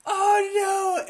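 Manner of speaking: high, loud panicked cry; panicked shout. Retold or imitated. Gamer reliving a botched ambush, shouting in high alarm. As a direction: yelp it in sudden alarm. His target starts running away.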